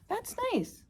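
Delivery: high, soft monkey voice; a small monkey, pleased. Acted. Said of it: "said Monkey".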